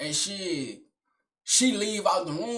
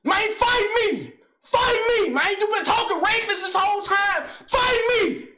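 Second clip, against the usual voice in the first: screaming challenge; 12 semitones higher, 5 dB louder than usual. Retold or imitated. Uncle screaming at the narrator to fight, much higher and loud. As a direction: bellow the challenge at him. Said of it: "This him".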